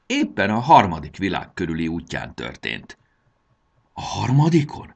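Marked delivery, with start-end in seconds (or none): 3.9-4.9 high, wondering query; amazed question. A narrator's reading. The checked suitcase marvels at the third voyage, amazed question. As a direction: ask in wonder, pitch lifted. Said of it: "csodálkozott kockás Koffer".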